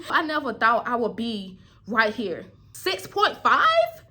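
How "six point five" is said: slow, high doubtful question; surprised question. Real, not acted. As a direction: question your own number. Questions her own rating.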